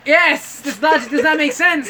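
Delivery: high, loud, laughing protest; exasperated outburst. Real, not acted. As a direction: cry out in amused disbelief. Exasperated outburst at friend's absurd joke.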